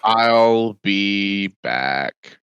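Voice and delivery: very slow, low, depressed action star; Arnold Schwarzenegger. Retold or imitated. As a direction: drag it out low and glum. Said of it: "he's getting more and more depressed".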